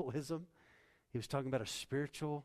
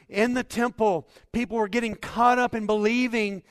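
loud, high, fervent preaching; preaching at full force; 8 semitones higher, 15 dB louder than usual. Acted. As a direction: build it up and proclaim to the church. Preaching climax to the church.

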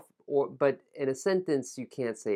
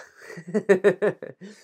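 higher, louder burst of giggles; real laugh; 6 semitones higher, 6 dB louder than usual. Real, not acted. Teacher stumbling on a tongue twister, laughing. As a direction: crack up at your own slip.

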